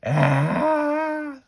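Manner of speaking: loud, very high long scream; piercing play-acted "Ah!"; screaming in someone's face. A narrator's reading. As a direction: scream high and hard to scare. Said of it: "I screamed right in his face".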